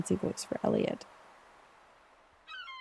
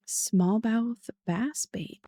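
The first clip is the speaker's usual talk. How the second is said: ordinary delivery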